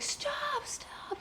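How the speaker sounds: high, hushed mock scream; whispered cry of stop. Mom standing between them in the retold fight, whispered cry. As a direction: imitate a desperate shout in a whisper.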